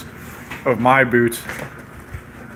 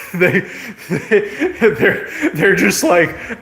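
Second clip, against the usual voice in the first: laughing; 5 semitones higher, much slower than usual. Real, not acted. Cracks up at his own joke.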